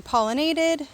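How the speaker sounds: "pollinated" with the stress pushed onto NA; stress on wrong syllable. Real, not acted. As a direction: lean on the NA in "pollinated". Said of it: "emphasis on the wrong syllable".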